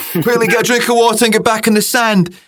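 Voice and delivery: a drill sergeant hurrying the team along; strict coach. Retold or imitated. Coach Nikki ordering players back to the sand, barked.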